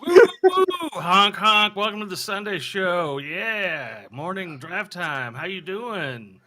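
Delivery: loud, high, zany radio host; goofy morning-show hype voice; wacky morning DJ. Pretend. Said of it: "I'm going wacky morning crew".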